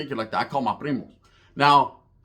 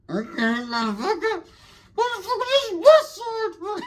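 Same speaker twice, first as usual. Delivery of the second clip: high, slow squeal of mock anger; mock pissed; slower than usual. Gang member imitating his pissed-off reply to armed rivals, mock pissed. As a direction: put on a squeaky, drawn-out strained voice. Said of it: "I was pissed".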